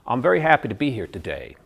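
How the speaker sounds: American tune that lands hard on "today"; American hard landing. Shown as a demonstration. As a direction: general American, hard final fall. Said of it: "they come down hard at the end".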